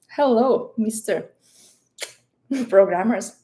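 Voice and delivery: ordinary talk, nothing marked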